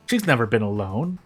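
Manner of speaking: plain reading voice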